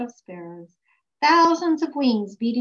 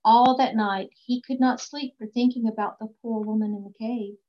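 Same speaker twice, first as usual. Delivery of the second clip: ordinary delivery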